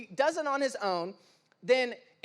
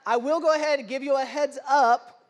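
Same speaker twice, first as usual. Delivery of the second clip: usual speaking voice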